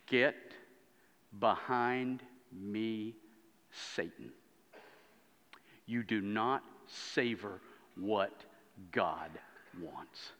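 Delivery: slow, low rebuke, word by word; solemn rebuke. Acted. Jesus rebuking Peter, slow, grave and deliberate. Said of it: "He's rebuked".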